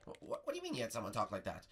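ordinary talk

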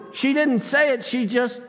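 ordinary talk, nothing marked

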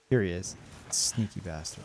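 soft low mutter under breath; muttered aside. Real, not acted. Streamer spots hidden rabbit, quiet low mutter. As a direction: grumble it to yourself.

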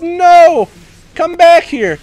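high, loud desperate yell; frustrated shouting. Real, not acted. Gamer yelling after an escaping enemy, high frustrated shout. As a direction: shout after someone getting away.

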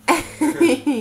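loud burst of laughter; amused laugh. Real, not acted. Mother laughing at her toddler's elephant growl. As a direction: crack up at the silly growl.

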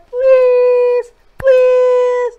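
high, loud whiny begging; pleading. Real, not acted. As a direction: beg the viewers playfully. Begging viewers at outro.

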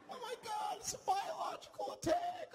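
very high panicked cry; panicked shriek. Retold or imitated. Panicked passenger shrieking about an attack, very high scream. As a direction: freak out like the bus is under attack.

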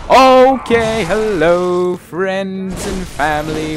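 loud, high, stretched-out welcome; hammed-up host's hello; big show greeting. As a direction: belt out the greeting, easing down by the end. Mock show-opening greeting.

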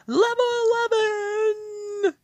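high, loud and stretched out; excited shout. Real, not acted. Gamer shouting about reaching the next level, triumphant. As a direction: yell it out as a triumphant cheer.

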